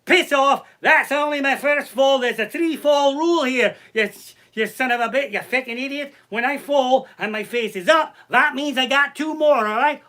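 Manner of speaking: put-on high, loud indignant Irish accent; shouted comic Irish tirade; Irish woman yelling. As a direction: tell them off in a hammed-up Irish voice. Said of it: "and she's like".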